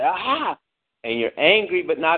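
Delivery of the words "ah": frustrated groan. Retold or imitated. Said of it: "look to the air and say".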